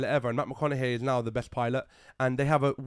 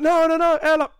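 high, loud mock alarm; hammed-up desperate shout; panicked warning; 14 semitones higher, 10 dB louder than usual. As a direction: yell out as the frantic crew. Acting crew's panicked warning.

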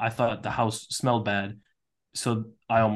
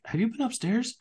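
much higher, alarmed question; 12 semitones higher than usual. Retold or imitated. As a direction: ask it suddenly worried.

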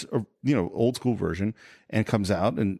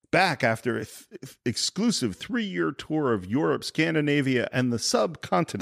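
slow, higher-pitched emcee announcement; imitating a showman's introduction; stage introduction; 4 semitones higher than usual. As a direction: introduce the band grandly, savoring each place. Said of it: "I love his introduction".

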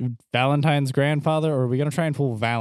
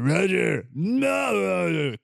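mock yelling; 6 semitones higher, much slower than usual. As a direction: yell the name like arguing guards. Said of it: "yelling at each other".

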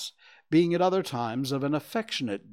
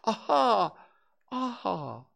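experimental, drawn-out rehearsal; practicing; 8 semitones higher, slower than usual. A narrator's reading. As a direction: test the word over and over.

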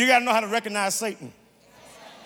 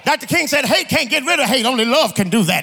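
loud, high-pitched sermon delivery; thundering preacher, driving each phrase home; full-force preaching; 7 semitones higher, 11 dB louder than usual. Acted. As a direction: preach it out to the whole congregation.

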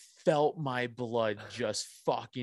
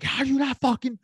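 much higher, louder, laughing rant; mock road rage; 13 semitones higher, 4 dB louder than usual. Stuck behind the lady at the light, a laughing mock rage rant. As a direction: yell at the driver through a laugh. Ranting at the driver ahead.

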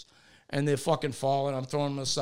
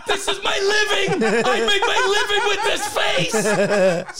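very high hammed-up lament; mock a vain man wailing over an injury; high, melodramatic retold outcry; imitated anguished wailing; 14 semitones higher, 4 dB louder than usual. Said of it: "He goes".

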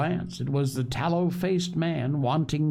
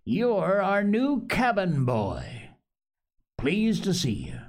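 higher, drawn-out welcome; hearty greeting; 7 semitones higher, slower than usual. A narrator's reading. Silver welcomes the new cabin boy, heartily. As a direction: greet him heartily with lifted pitch. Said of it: "he said quite loudly".

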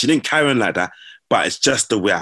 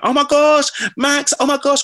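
very high, louder excited squeal; squealing fan; 3 dB louder than usual. Retold or imitated. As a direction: shriek like a starstruck fan. Fans squealing on seeing him.

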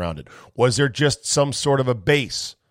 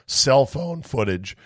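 normal talking voice

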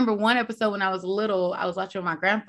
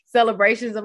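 normal talking voice

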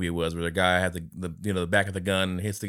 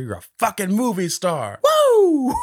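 high, loud mock pep-talk ending in a whoop; hyped-up cheer; 13 semitones higher, 7 dB louder, slower than usual. Young Nick hyping up his older self, high loud whooping cheer. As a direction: pump him up like a cheering buddy.

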